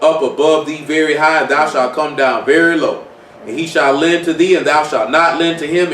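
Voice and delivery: steady, measured reading of the verse; scripture reading. Acted. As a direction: read it out like the King James.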